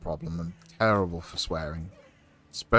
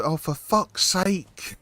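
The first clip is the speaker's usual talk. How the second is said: put-on higher, slow, drawn-out toddler swearing; imitating toddler daughter; 8 semitones higher, 5 dB louder, much slower than usual. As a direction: mimic a cross little girl stretching every word. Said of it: "So she'd turn around and go".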